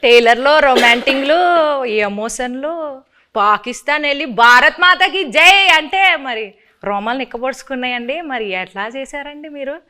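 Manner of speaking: host in a put-on Srikakulam village accent; playful Srikakulam dialect, lightly done; Srikakulam slang. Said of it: "కొంచెం Srikakulam slang బానే ఉందండి".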